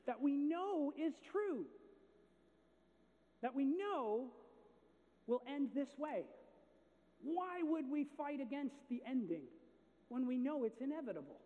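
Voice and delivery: rising sermon climax, voice lifted; preaching at full force. Acted. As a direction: drive it home from the pulpit.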